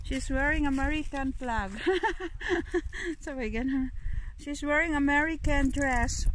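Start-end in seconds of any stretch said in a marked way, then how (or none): 1.8-3.2 soft, higher giggle; quiet chuckling laugh; amused giggle. Real, not acted. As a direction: giggle lightly at the dog. Amused by the dog's outfit.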